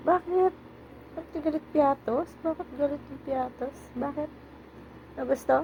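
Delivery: high-pitched cooing questions to a pet; cooing teasing. Real, not acted. Asking Piatos why it is angry, cooing and teasing. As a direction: pester a sulky pet in a high little voice.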